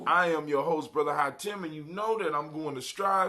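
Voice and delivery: big radio MC welcome; hype radio host. Acted. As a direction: pump up the crowd like a live DJ. Hyped host announcing the show.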